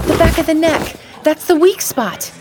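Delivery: high urgent yell across the room. Acted. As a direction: shout the warning over the chaos. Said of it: "Carrie yelled".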